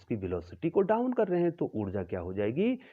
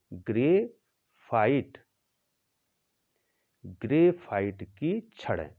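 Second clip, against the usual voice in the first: slow dictation; much slower than usual. Shown as a demonstration. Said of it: "teacher spelling out term".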